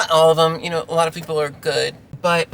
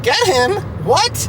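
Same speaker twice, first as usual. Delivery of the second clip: high, loud disbelief, pitch jumping up on What; shocked that she'd ask, voice shooting up; incredulous; 12 semitones higher, 5 dB louder, slower than usual. Retold or imitated. Driver stunned when the owner asks her to catch the dog, shocked loud. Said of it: "And I'm like".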